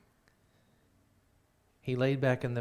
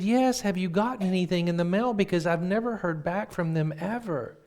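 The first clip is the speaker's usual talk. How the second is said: high, loud, urgent demand; imitating impatient brother; 8 semitones higher, 5 dB louder than usual. Brother asks sister for his North Pole reply, urgently impatient. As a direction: press for news, pitched up and louder.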